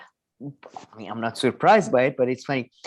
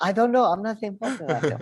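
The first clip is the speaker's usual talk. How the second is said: higher mock politician ducking the question; Rishi Sunak impression; 7 semitones higher than usual. Mocking Rishi Sunak ducking the follow-up, pitched up. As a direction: toss off a quick evasive denial. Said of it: "and he's like".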